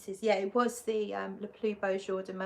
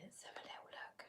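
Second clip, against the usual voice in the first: whisper; 21 dB quieter, faster than usual. Real, not acted. Quiet aside while reading comments.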